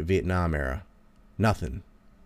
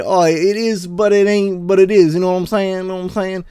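Quentin Tarantino; 9 semitones higher, 6 dB louder than usual. Retold or imitated. Tarantino giving interviewers non-answers, high mocking fake-slang voice. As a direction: imitate a try-hard faking slang, pitched way up.

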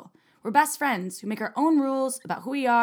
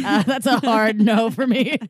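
loud imitation of a flat refusal; mocking friend; 2 semitones higher, 6 dB louder than usual. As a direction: parody the friend's hard no. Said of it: "Amy's like".

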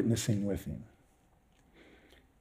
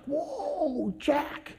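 very high, hushed amazement; amazed whoa; 12 semitones higher, 7 dB quieter than usual. Retold or imitated. Marvelling to himself as a stranger thanks him, high and hushed. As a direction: breathe it out in wonder. Amazed after tract accepted.

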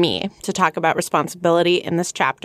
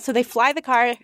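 ordinary talk, nothing marked

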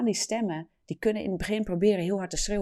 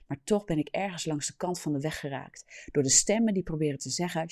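usual speaking voice

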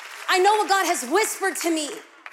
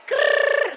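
rolled, drawn-out "grrr" of scratching out; relishing scratch-it-off sound; scratching noise; 10 semitones higher, 4 dB louder than usual. Acted. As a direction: imitate a hard pen scratch with a growly trill. Imitates scratching an item off list.

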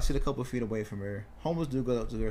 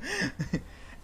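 laugh; 3 dB quieter than usual. Real, not acted. Laughing at his own retort.

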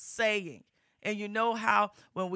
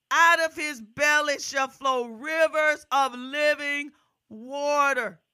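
rising, louder gospel preaching, driving each phrase; full-force preaching; 6 semitones higher, 7 dB louder than usual. Acted. Preacher urging the congregation, full-force preaching. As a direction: preach it out to the whole congregation, building.

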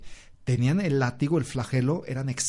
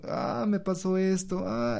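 mock whining complaint; 6 semitones higher than usual. Mocking her own complaining.

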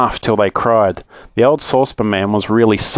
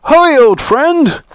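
high, loud, drawn-out hail; excited yell; 5 dB louder, much slower than usual. Acted. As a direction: holler slowly down to an old friend. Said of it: "he yelled".

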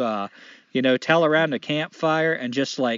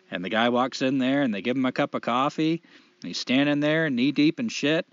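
normal talking voice

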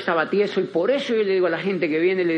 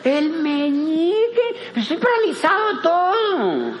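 whiny patient; 8 semitones higher than usual. Retold or imitated. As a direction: wail the protest through a laugh. Patient protests to physio.

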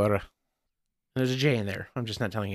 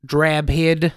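louder mock Southern drawl; Southern accent; 3 semitones higher, 6 dB louder than usual. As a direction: give it a broad Southern twang. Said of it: "in a southern accent".

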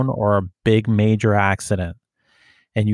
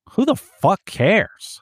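high, slow mocking scoff; dismissive scoff; 9 semitones higher, slower than usual. Cop dismissing the name-dropper, slow high scoff. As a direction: brush it off with disdain. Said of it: "Like, good for you".

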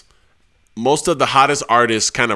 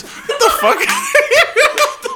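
laughing disbelief; 3 dB louder than usual. Real, not acted. Host reacts to [name] pick.

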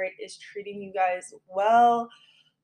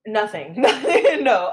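laughing; 7 dB louder, slower than usual. Real, not acted. Joking about own retrograde.

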